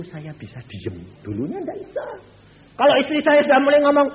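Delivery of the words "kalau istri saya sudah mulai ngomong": raised preaching voice, much higher; loud. Real, not acted. Preacher to the congregation, loud and high. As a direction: belt out the complaint about his wife. Preacher telling about his wife.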